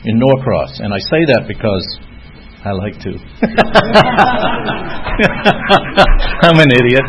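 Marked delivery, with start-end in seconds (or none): 3.2-6.4 big laugh. Real, not acted. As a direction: crack up laughing hard. Laughs at his own joke.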